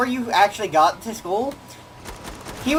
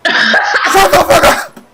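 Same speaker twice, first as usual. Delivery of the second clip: laughing mock yell; 9 semitones higher, 7 dB louder, much slower than usual. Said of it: "yell at my dogs".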